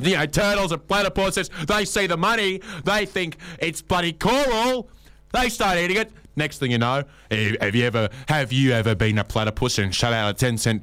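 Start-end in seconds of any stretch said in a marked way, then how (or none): 0.0-6.1 high, indignant voice for the turtles; mock indignant lecture; indignant rant. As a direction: rant pitched up in mock outrage. Acted scolding rant continues.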